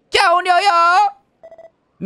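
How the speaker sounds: high, slow drawn-out mock shriek; mock scream. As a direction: shriek in fake panic at the ghost. Townsperson screams about ghost.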